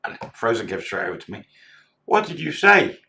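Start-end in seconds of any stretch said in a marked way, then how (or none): 2.1-3.0 high, loud, accusing question; mock loud demand. Barmaid confronting the manager, loud and high. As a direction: fire it at the manager.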